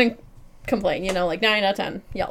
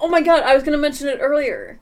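excited; 6 semitones higher, 6 dB louder than usual. Real, not acted. Keeps reacting to mansplaining topic.

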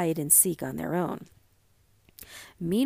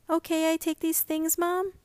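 put-on high girl voice; 9 semitones higher than usual. A narrator's reading. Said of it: "Mink asks her mother".

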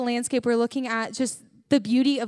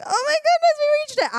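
much higher, thrilled cry; reliving the hype with a high cry; excited squeal. Retold or imitated. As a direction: shriek with joy at the summit. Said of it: "after my hype".

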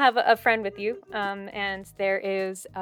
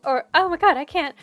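put-on high, breathless, gushing rescued girl, often fast; rescued maiden gushing thanks to her saviours; excited young woman; 7 semitones higher, 6 dB louder, faster than usual. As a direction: babble gratefully like a flustered village girl. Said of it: "She goes".

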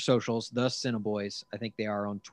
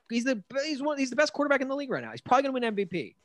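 rushed; 12 semitones higher, faster than usual. Real, not acted. Rushed high praise.

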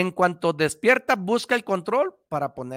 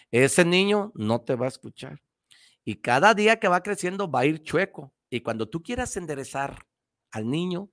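usual speaking voice

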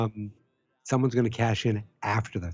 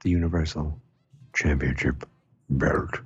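solemn pronouncement; 7 semitones lower than usual. Acted. Host declares Lesnar will lose the title, solemn low pronouncement. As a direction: sink very low, phrase by phrase.

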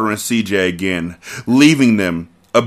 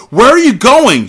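hammed-up woman; 8 dB louder than usual. Mia voiced as he stands.

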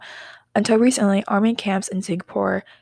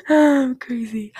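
laughing; 7 semitones higher, 5 dB louder, slower than usual. Real, not acted. Laughs at her own rambling.

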